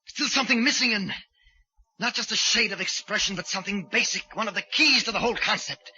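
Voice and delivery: high-pitched, agitated soliloquy; obsessed killer. Acted. Killer agitatedly reasoning with himself.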